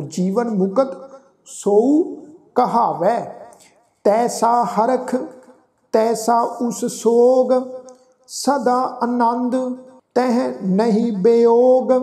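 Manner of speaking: slow, measured sacred verse; scripture recitation. Shown as a demonstration. Teacher reciting Gurbani for the congregation, slow recitation. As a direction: recite slowly, drawing out the long vowels.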